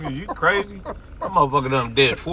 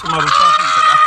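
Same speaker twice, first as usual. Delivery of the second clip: incredulous yell; 6 dB louder than usual. Real, not acted. Mocking friend as water scientist.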